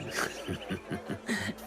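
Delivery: quiet breathy chuckle under the breath; amused low chuckle; soft chuckle. Acted. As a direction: laugh softly at the insult. Amused at being called old.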